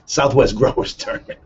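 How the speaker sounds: usual speaking voice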